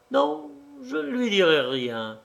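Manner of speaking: usual speaking voice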